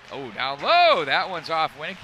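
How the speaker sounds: loud rising call on a scoring chance; announcer reacting to a shot on net, excited and loud. Real, not acted. Dangerous chance on power play.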